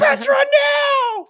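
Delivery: very high, loud, stretched-out wail; mock anguished scream. Eren watching Petra squished, hammed-up anguished shriek. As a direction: scream her name in cartoon despair. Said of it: "and he goes".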